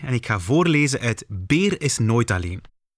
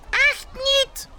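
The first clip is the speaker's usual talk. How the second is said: very high little squeak. Acted. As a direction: squeak the denial from hiding. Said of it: "piept Beer". (heel hoog gepiep)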